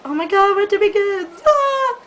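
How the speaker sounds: high, loud excited squeal. Real, not acted. Excited about winter break.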